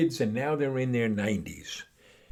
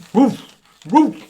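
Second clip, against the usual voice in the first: high loud yappy woof; dog barking; 13 semitones higher, 13 dB louder than usual. Acted. The dog answers the man, barking. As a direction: bark it out like a dog.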